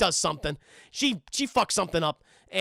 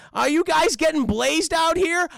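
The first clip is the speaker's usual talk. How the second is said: higher, louder goofy cartoon slacker; Shaggy; 7 semitones higher, 6 dB louder than usual. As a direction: impersonate the stoner sidekick. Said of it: "He's like".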